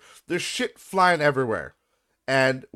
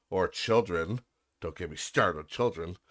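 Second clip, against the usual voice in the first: much lower, softer throwaway; muttered aside; 10 semitones lower, 8 dB quieter than usual. Real, not acted. Aside to viewers about children, muttered. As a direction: mutter it under your breath.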